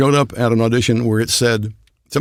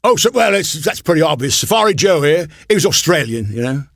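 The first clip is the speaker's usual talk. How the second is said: raised, punchy East London voice; East London accent; 6 semitones higher, 4 dB louder than usual. Acted. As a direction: put on a cartoon East Londoner, pitched up. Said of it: "East London for some reason".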